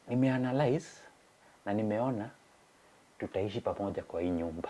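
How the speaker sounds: soft, settled statement from the wife; quietly voiced wife. Wife calmly announces her decision.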